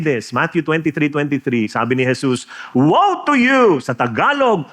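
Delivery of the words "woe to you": slow, loud condemnation, voice raised high; angry woe. Retold or imitated. Jesus rebuking the Pharisees, angry slow woe. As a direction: thunder it out like Jesus rebuking them. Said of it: "Galit na galit".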